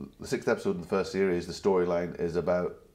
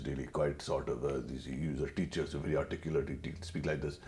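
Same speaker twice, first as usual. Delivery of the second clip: a hint of a careful, well-spoken father; my dad's voice; 5 semitones lower, 8 dB quieter than usual. Imitating his articulate teacher father, lower and softer. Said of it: "my dad talks like this".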